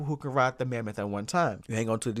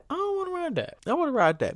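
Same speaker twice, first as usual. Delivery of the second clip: whiny refusal. Retold or imitated. Refusing family's coaster offer.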